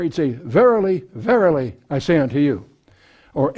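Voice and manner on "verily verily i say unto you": both Verily words punched, then I say unto you; triple emphasis. Shown as a demonstration. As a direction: underline it three times with Verily, verily. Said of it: "put three underlines in there".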